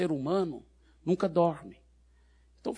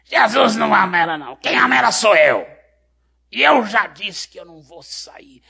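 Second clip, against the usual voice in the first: very loud, higher demonic roar; defiant demon; 5 semitones higher, 12 dB louder than usual. Retold or imitated. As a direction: roar back like a possessive evil spirit. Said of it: "ele respondeu".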